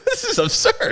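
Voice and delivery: very high, hushed exaggerated gasp voice; mock scandalized person. Friend mocking people online, high scandalized voice. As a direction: squeak it up like someone appalled. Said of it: "people are like".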